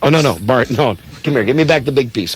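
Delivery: ordinary talk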